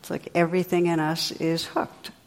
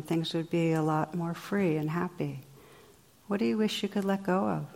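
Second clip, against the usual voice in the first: soft, gentle meditation voice; guided reflection; 4 dB quieter than usual. Acted. Teacher guides meditators to reflect on habits, soft and gentle. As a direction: lead listeners inward quietly.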